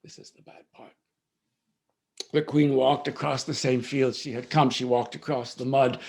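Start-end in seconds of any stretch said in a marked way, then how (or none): 0.0-0.9 hushed warning aside; whispered aside. Real, not acted. Storyteller warns listeners of what comes, whispering. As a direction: drop to a whisper to warn them.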